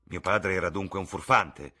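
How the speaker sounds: loud, furious shout. Acted. Son shouting at his mother about his father, furious. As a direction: yell it with fists clenched. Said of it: "gridò il ragazzo stringendo i pugni".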